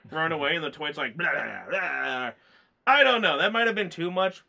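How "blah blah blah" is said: mock monster taunt.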